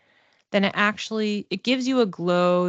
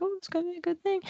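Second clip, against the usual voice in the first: mock upbeat, much higher and softer; a lightly imitated self-assuring chirp; breezy reassurance; 12 semitones higher, 7 dB quieter, much faster than usual. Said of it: "and you're like".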